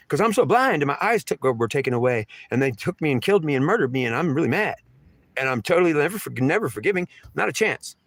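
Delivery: high-pitched, aggrieved rant; wounded, furious protest, acted out; angry outburst. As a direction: pour out the grievance, pitch riding high. Voices her rage as "she.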